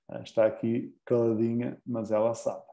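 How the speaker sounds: ordinary delivery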